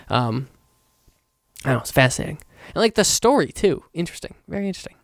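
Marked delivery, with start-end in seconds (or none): 3.9-5.0 mock musing murmur. Musing on the story.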